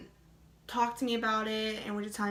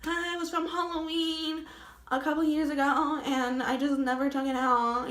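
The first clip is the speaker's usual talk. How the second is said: higher, flustered explaining voice; nervous stammering; 4 semitones higher than usual. Retold or imitated. Driver explains the bat in her car to police, flustered and higher. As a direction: stumble through the excuse, rattled. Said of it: "I'm stuttering as I'm saying this".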